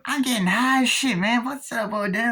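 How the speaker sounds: high-voiced stoner greeting; stoned actor impression. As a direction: play the guy who's high and happy about it. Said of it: "doing the impressions of the, uh, actors".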